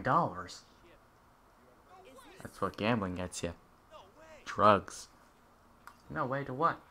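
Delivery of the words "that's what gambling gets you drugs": ordinary delivery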